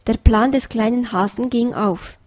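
plain reading voice

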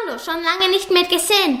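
quick, high, cheery greeting; Oskar's greeting. Acted. Neighbour dog greets old friend, quick and high. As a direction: rattle off a cheerful hello.

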